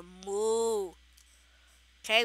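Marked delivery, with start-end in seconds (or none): 0.0-0.9 mock moo. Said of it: "I'm looking for".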